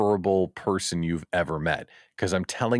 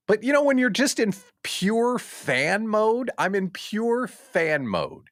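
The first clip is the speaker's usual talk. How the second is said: excited; 13 semitones higher, slower than usual. Real, not acted. Fan mode.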